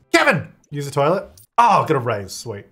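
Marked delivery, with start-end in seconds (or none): 0.1-0.5 higher, loud call across the house. Real, not acted. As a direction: call Kevin's name to get him moving. Calling out to his Sim.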